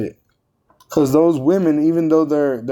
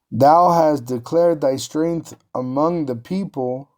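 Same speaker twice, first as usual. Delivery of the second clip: slow scripture reading; slower than usual. Shown as a demonstration. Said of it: "scripture read slowly".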